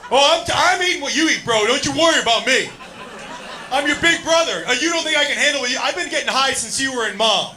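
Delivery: cocky brother. Retold or imitated. Older brother boasting to comedian, loud cocky swagger. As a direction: puff up like the tough older brother. Brother bragging, acted quote.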